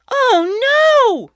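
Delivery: very high, loud panicked cry; alarmed cry. A narrator's reading. Mother realising the baby is gone, high and panicked. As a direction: gasp in sudden fear. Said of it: "Mother cried".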